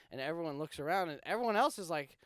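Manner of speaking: ordinary talk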